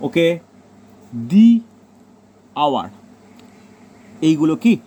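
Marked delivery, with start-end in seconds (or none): 1.3-2.9 "দি আওয়ার" with the as dee; the before a vowel. Shown as a demonstration. Teacher giving a second vowel example, "দি" as dee. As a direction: give "দি" a clear long ee before "আওয়ার". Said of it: "এইগুলো বলতে পারি যেহেতু vowel sound".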